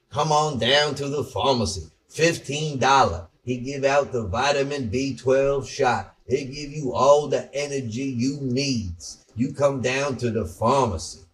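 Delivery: hammed-up Southern small-town pharmacy ad voice; imitating a Southern pharmacist. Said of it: "talk like this guy who's in this market".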